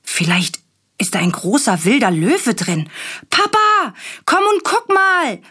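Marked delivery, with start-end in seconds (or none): 3.3-5.4 put-on urgent child. A narrator's reading. Willi calling Papa that he needs the potty, high and urgent. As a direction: plead like a child who really has to go. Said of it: "ruft Willi".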